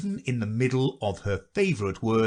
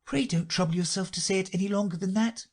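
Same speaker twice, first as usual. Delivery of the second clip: Alice voice; 9 semitones higher, faster than usual. A narrator's reading.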